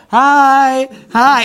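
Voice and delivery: high, drawn-out cheery hi; big-smile greeting. Retold or imitated. Dad answering an enraged driver, big-smile greeting. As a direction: greet them with a huge smile in the voice. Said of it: "just smile real big and go".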